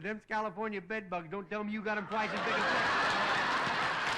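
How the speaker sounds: can barely get the joke out for laughing; laughing through punchline. Real, not acted. Telling the lieutenant about the bed bugs, laughing.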